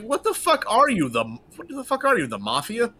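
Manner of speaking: high incredulous outburst; outraged disbelief. Retold or imitated. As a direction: demand it like they're insane. Outraged retort to threats.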